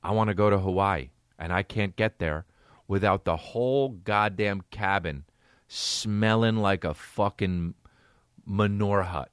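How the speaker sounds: low, lazy entitled voice; deep put-on voice of a self-absorbed traveler; mock entitled passenger. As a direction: sneer it like a selfish passenger who doesn't care. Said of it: "imagine being that fucking egocentric that you're like".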